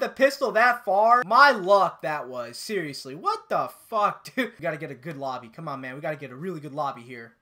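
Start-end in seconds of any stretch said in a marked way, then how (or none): none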